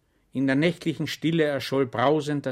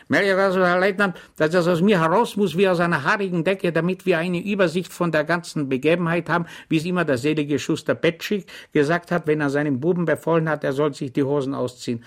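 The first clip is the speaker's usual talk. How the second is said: higher, louder good-natured soldier; Schwejk voice; 5 semitones higher, 6 dB louder, faster than usual. Acted. Schwejk rattles on to the lieutenant, eager. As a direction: babble it out eagerly.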